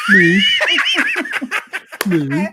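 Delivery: put-on a laughing Birmingham-accent moo; Brummie cow moo, laughing. Brummie moo for the mates, through laughter. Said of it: "do a Brummie cow".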